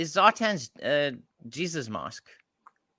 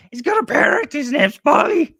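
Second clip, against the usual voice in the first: put-on squeaky child act; 11 dB louder than usual. Co-host joining in as child showing grandma toys, squeaky.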